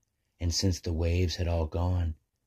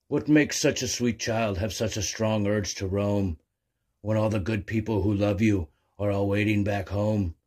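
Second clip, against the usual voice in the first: gentle booming whale; 3 semitones higher, 4 dB louder than usual. Acted. Whale gently scolds the wandering young albatross, lightly booming. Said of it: "but with a trembling boom".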